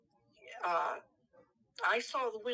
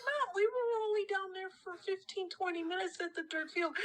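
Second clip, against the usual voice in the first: pleading; 10 semitones higher than usual. Retold or imitated. Kid pleading with worried mom.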